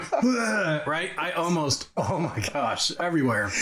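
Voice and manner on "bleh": a high, retching bleh of disgust; disgusted gag. Retold or imitated. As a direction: gag at the taste as if about to throw up. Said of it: "And I went".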